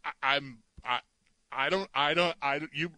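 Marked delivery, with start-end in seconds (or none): none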